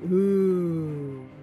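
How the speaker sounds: normal talking voice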